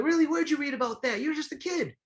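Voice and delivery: put-on high, playful voice teasing a kid; mock-surprised, very high-pitched quote; Emil Griffith. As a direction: act amazed at a young fan, way up high. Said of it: "he was like".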